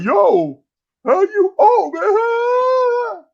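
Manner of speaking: very high, wailing imitation of hecklers; hammed-up high-pitched jeering; mock outcry. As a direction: squeal in exaggerated shock. Imitates everybody mocking his age.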